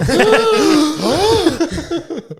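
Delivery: a sudden high burst of laughter; big laugh. Real, not acted. Cohost jokes about a gasp noise, he bursts out laughing. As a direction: crack up loud and high.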